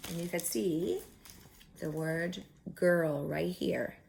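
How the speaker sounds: ordinary delivery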